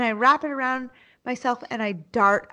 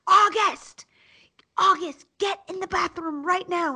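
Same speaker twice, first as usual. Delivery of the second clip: much higher, urgent yelled command; yelling; 9 semitones higher than usual. Retold or imitated. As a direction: summon the child at once. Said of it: "And I start yelling".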